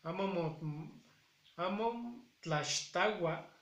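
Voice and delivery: "amo tlaxtagua" leído despacio, sílaba por sílaba; lectura de lo escrito. Shown as a demonstration. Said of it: "habla sobre un evento y dice". ("amo tlaxtagua" leído lentamente tal como está escrito)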